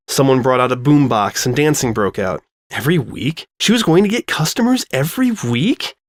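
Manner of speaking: ordinary delivery, nothing marked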